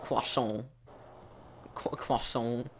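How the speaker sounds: croissant said the French way, as kwasso, quiet; mock French accent. Shown as a demonstration. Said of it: "went from posh Cockney to French".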